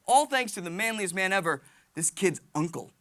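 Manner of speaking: ordinary talk, nothing marked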